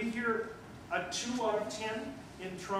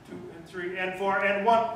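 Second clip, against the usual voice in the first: imitating the band; 8 dB louder than usual. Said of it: "And this is what I heard".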